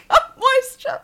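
high, breaking into laughter; laughing repeat of the running joke; giggled catchphrase. Pretend. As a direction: squeak it out through giggles.

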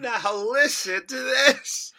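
slow, high, loud put-on voice; drawn-out mimicry, hammed up; echoed quote. Co-host echoing the quote, in a slow hammy voice.

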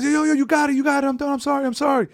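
high, hurried imitated surrender; mock pleading. Retelling the man begging for his phone, mock pleading. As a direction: beg like a beaten man. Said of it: "he comes out".

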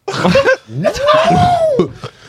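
big loud laugh; loud burst of laughter. Real, not acted. Loud laugh at end of chat.

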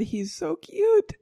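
cooing. Real, not acted. Cooing over the can.

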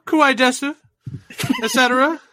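put-on high, loud e-girl squeal, laughing; cutesy anime girl, laughing. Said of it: "The, the outfit change was incredible".

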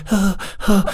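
soft, breathy little huh huh of a newborn; newborn's faint breaths. Retold or imitated. Dad imitating his newborn son on his chest, soft little breaths. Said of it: "this little thing going".

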